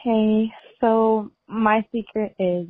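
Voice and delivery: ordinary delivery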